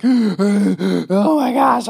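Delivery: a runner suddenly realizing they can't breathe; startled, drawn-out panic; panicked gasp. Retold or imitated. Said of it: "then you're like".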